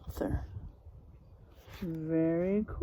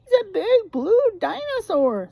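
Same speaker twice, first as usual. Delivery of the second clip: higher, louder, excited guess; excited badger; 10 semitones higher, 8 dB louder than usual. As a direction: shout the wrong answer proudly. Badgers voiced in story.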